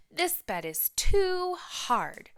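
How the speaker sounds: high, slow, fussy penguin voice; Tacky voice. Acted. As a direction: stretch it out, high and picky. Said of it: "said Tacky Locks".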